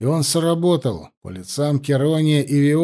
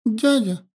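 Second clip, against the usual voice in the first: put-on much higher, sweet child voice; little girl; 8 semitones higher than usual. A narrator's reading. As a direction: chirp like a small girl. Little girl speaks to giant.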